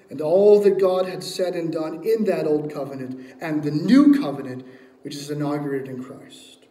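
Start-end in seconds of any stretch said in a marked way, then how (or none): none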